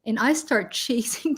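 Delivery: ordinary talk, nothing marked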